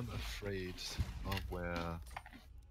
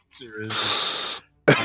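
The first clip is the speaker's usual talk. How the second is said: laughing; 2 semitones higher, 17 dB louder, slower than usual. Real, not acted. Trying to stop laughing.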